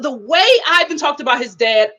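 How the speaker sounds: usual speaking voice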